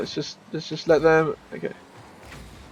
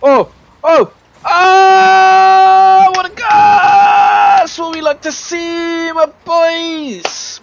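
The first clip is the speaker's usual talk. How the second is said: much higher, louder drawn-out shout; goal celebration; 13 semitones higher, 6 dB louder, much slower than usual. Real, not acted. Gamer celebrating his team's goal, much higher and louder. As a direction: roar it like a football commentator.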